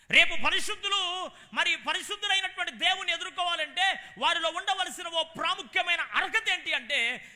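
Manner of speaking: loud, high, ringing preacher's cry; full-force preaching. Acted. Preacher exalting the man of God to the congregation, loud and high. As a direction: preach it out to the whole hall, rising high.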